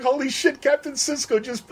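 high-pitched fanboy awe; mock starstruck gushing. Retelling being starstruck.

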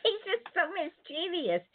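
said through a high giggle; laughing. Real, not acted. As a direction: laugh while you say it. Laughing about the mischievous elf.

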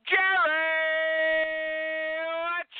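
high call to a co-host; radio host's high cue to his sidekick. Acted. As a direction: sing out the cue to Jerry. Host calls out to co-host.

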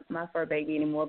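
ordinary delivery

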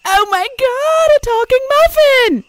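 high, loud, shocked shriek. Acted. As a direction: scream it like a startled muffin. Shocked muffin in joke.

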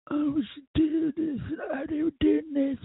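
high, slow, squeaky whine mocking the pundits; mocking whiny pundits. Mocking Hannity and Levin's complaints in a high whiny voice.

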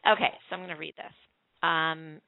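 usual speaking voice

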